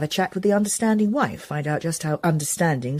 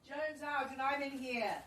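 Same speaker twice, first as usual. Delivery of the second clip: from another room; 7 semitones higher, 16 dB quieter, slower than usual. Acted. Answering quietly from another room.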